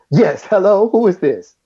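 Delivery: put-on high, bright-edged old showman on the phone. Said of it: "he sounded just like you think he would sound".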